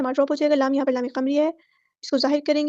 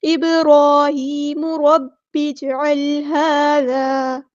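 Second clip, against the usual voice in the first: slow, drawn-out chanted recitation; tajweed chant; Quranic recitation; 4 dB louder, much slower than usual. Shown as a demonstration. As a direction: recite it melodically, stretching each sound. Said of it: "recites Quran verse in tajweed".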